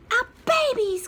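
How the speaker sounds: high, loud joyful cry; happy cry. Acted. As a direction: cry out in delight. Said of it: "cried the happy little chickens".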